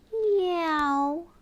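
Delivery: a sung-out kitty meow; be the ginger cat saying hello. Acted. Voicing Mister Tiddles for a toddler, playful meow.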